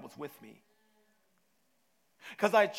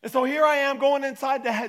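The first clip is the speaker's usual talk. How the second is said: ordinary talk, nothing marked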